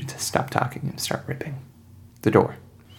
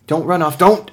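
rising, louder plea; urgent call; 7 semitones higher, 5 dB louder than usual. Acted. To Sergei running off, high urgent call. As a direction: call out to stop him. Pleading teammate to stop.

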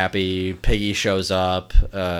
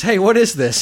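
higher, louder mock annoyance; Doc's exasperated voice; 11 semitones higher, 5 dB louder than usual. Doc puzzled by the letter game, mock exasperation. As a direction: toss it up in puzzled protest. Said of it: "Doc says".

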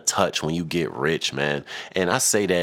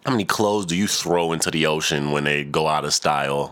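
usual speaking voice

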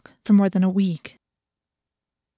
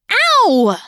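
sudden high loud cry of pain; pained yelp; 11 semitones higher, 8 dB louder than usual. A narrator's reading. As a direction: yelp as claws rake the hand. Scratched by the cat.

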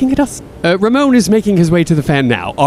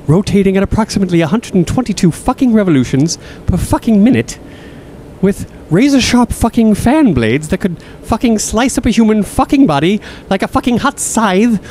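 building rant; 7 semitones higher than usual. Acted. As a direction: climb higher with every fucking. Escalating rant about the fan.

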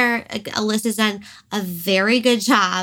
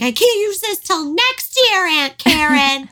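mock ungrateful kid; 9 semitones higher, 5 dB louder than usual. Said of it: "Be like".

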